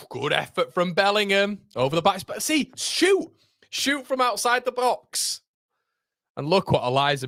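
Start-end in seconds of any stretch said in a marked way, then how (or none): 2.3-5.4 high, slow, drawn-out yell; excited urging. Real, not acted. Fan yelling at the player to shoot, high and drawn out. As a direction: urge him to shoot from range, way up high.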